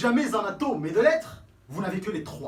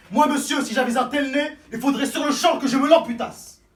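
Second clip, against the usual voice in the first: loud, higher, aggressive bluster; 5 semitones higher, 9 dB louder than usual. Acted. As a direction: bark it like a threat.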